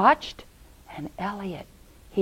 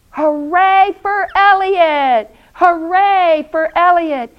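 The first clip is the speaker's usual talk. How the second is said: crowd cheering; 9 semitones higher, 7 dB louder than usual. Acted. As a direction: cheer the hero at full voice. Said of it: "And the people said".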